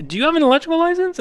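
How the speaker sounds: high, fast challenge; retorting. Retold or imitated. She asks the store clerk if he's licensed, high and fast. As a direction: snap back a pointed question. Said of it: "and I'm like".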